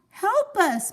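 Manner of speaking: high, loud plea; wife calling for rescue; calling for help. Acted. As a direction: call out desperately. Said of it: "Missus Watson called".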